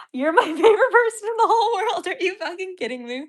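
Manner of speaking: very high, fast words breaking into laughter; squealing and laughing at the donor; laughing hysterically. Real, not acted. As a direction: giggle through it, overwhelmed with joy. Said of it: "I'm losing my mind over these donations".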